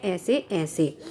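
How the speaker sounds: low, soft whimpering moan, faking a tummy ache; tearful moan. Acted. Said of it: "बार बार कहता है". (धीमी कराहती रोनी आवाज़)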